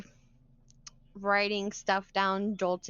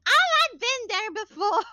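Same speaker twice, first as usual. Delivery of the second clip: higher, louder bubbly NPC line; higher redo take; 13 semitones higher, 7 dB louder, faster than usual. Shown as a demonstration. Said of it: "why did I change her accent".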